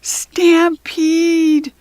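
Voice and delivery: loud high warning shout; yelled. A narrator's reading. Kirsty warning of charging zebras, shouting. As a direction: yell to warn everyone. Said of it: "Kirsty turned around and yelled".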